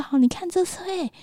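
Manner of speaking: mother showing her crying son his toy car, coaxing. Retold or imitated. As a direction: tempt the child gently.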